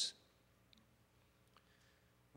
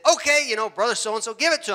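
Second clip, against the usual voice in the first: higher, cheering him on; egging him on; 6 semitones higher, 4 dB louder, faster than usual. Retold or imitated. Preachers urging the blind man to yell, excited encouragement. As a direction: urge him to let it rip. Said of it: "They say".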